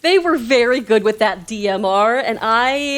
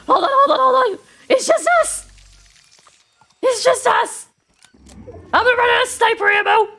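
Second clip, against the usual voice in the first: high, loud frantic yelling; frantic high shouts, fast at the end; panicked shouting; 5 dB louder than usual. Real, not acted. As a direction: yell in panic as the fight turns. Last two alive, panicking.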